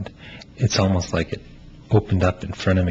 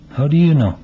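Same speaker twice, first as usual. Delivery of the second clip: lightly voiced young man, a little higher and louder, searching; Melvin; 7 semitones higher, 5 dB louder than usual. Playwright reads Melvin pressing his friend, lightly pleading. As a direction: press your friend for who you are, half-pleading.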